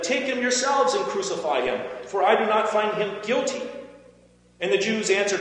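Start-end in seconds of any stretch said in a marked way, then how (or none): 0.0-3.6 higher, raised voice of refusal; firm protest, pitched up; exasperated refusal. A narrator's reading. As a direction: push the demand back at the crowd. Pilate refuses the crowd's demand.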